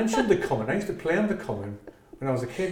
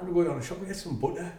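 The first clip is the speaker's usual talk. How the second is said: put-on Middlesbrough accent; 8 dB quieter, faster than usual. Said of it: "Middlesbrough accent".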